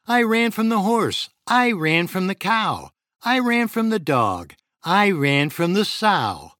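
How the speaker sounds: high, loud boastful runaway cookie; gingerbread man crying out. A narrator's reading. Gingerbread man boasting loudly to the fox he can't be caught. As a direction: taunt the fox in a high, loud sing-song brag. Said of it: "The gingerbread man cried".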